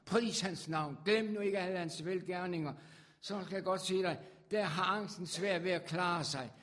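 loud, rising sermon cry to the congregation; preaching at full force. Acted. Preacher calling out to God's people, fervent loud preaching. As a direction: preach it out, lifting the room.

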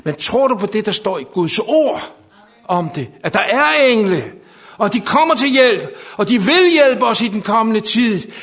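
loud, high, insistent proclamation; full-force preaching. Real, not acted. Preacher promises the camp help from the Lord, full-force preaching. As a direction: drive the promise home to the congregation.

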